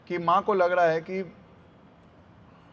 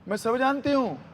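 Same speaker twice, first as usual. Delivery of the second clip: higher-pitched scolding gopi; डांटती गोपी; 6 semitones higher, 3 dB louder than usual. Retold or imitated. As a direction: act out an insistent, indignant village woman. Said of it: "गोपी कहती". (डांटती हुई गोपी की ऊँची आवाज़)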